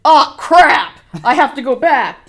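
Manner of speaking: very loud, high hammed-up alarm; playful shouted dismay; mock panicked yell. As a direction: yell it like a time traveler in pretend panic. Voicing a panicked time traveler.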